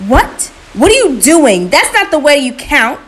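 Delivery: loud, high, outraged scolding; angry, high-pitched yell of disbelief; angry shout. A narrator's reading. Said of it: "Anansi shouted angrily".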